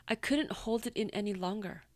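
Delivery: ordinary delivery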